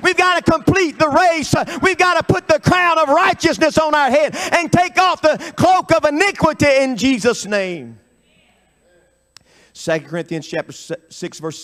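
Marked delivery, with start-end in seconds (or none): 0.0-8.0 high-pitched, rallying revival preacher; sustained, urgent pulpit delivery, voice pitched up; fervent preaching. Real, not acted. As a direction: drive each phrase home like a rallying cry.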